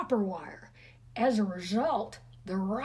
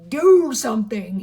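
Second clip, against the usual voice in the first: urgent self-command; 13 dB louder than usual. A narrator's reading. Fritz urging himself.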